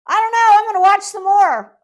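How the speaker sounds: ordinary delivery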